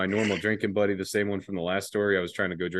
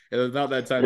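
ordinary talk, nothing marked

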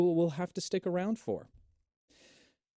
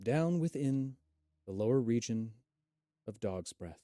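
game master narration. Acted. Game master's scene setting.

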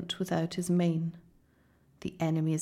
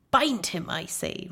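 witch's command. A narrator's reading.

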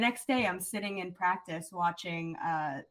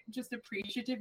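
ordinary talk, nothing marked

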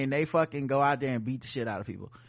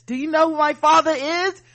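high, loud entitled demand; indignant shout; 11 semitones higher, 8 dB louder than usual. Retold or imitated. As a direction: demand it, outraged and superior. Entitled mask refuser, repeated louder.

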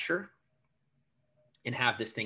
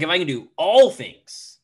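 "all" punched much louder and higher; emphasis on ALL; 5 semitones higher, 13 dB louder than usual. Retold or imitated. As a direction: hit "all" hard and stretch it. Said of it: "I would emphasize different words".

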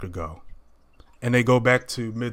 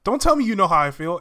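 high, louder string of protest; retold indignant refusal; 9 semitones higher, 4 dB louder, faster than usual. Peter rejecting Andrew's sympathy, imitated indignant refusal. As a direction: shoot down the sympathy, imitating his objection.

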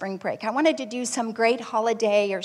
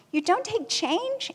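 higher, slow disbelieving rise on "don't"; can't believe what she's hearing; incredulous question; 9 semitones higher, slower than usual. Retold or imitated. Mom to clerk, stunned disbelief.

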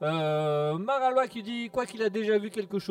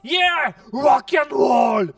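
slow, much louder rock-and-roll shout with "rock" stretched; rocker shout; 5 semitones higher, 11 dB louder, much slower than usual. Acted. Rocker character introducing himself to the listeners, slow bellowing shout. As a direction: bellow it like a rocker taking the stage. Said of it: "peux-tu vous présenter?".

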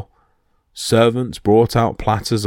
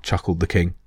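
usual speaking voice

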